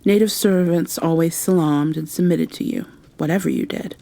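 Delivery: ordinary delivery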